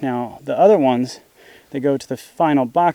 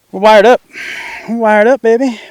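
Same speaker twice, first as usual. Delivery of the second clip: much louder and higher victory cry; triumphant; 7 semitones higher, 8 dB louder than usual. Real, not acted. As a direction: celebrate finishing the job. Triumphant after finishing wiring.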